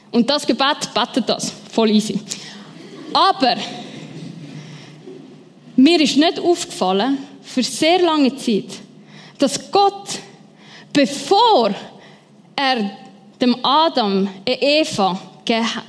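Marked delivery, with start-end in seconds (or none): none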